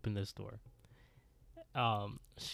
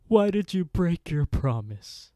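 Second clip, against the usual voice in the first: higher, louder accusing cry; angry scream; 7 semitones higher, 7 dB louder than usual. Acted. Madeline confronts Stacy over a broken promise, screaming. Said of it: "she screams with the roar of the thunder".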